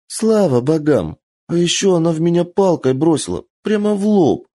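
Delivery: put-on high, pouty tattling child; spoiled rich girl. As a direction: complain like a pampered girl.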